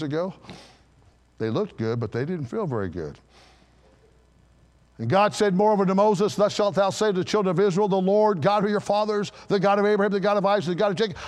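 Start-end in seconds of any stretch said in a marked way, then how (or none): 5.0-11.2 raised, driving scripture reading; preaching scripture. Acted. Preacher reading God's words to Moses, driving and raised. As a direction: build through the list, higher than usual.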